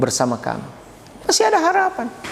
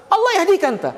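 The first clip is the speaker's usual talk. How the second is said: much higher, snapping reply; mock angry retort; 13 semitones higher, faster than usual. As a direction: fire it back at the other driver. (balasan marah pengendara lain, nada tinggi)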